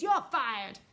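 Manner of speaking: loud, forceful dismissal; shouted. A narrator's reading. Miss Tibbs firing the army chief, shouted. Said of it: "shouted Miss Tibbs".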